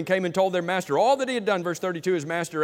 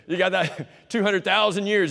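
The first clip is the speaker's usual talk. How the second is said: laughing through the line, louder; 4 semitones higher, 5 dB louder than usual. Real, not acted. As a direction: chuckle at how absurd it is. Preacher laughs at the absurd debt.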